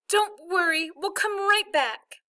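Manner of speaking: put-on high, earnest child's voice; little girl reassuring. A narrator's reading. Little sister promising brother she'll return, high reassuring voice. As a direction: comfort him in a high, slow child voice.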